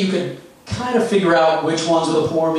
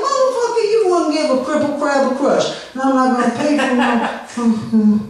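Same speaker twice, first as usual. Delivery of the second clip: impression of rambling comic, higher; 7 semitones higher than usual. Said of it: "I'm going to do his set for you right now, verbatim".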